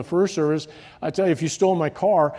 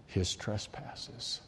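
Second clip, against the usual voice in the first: ordinary talk, nothing marked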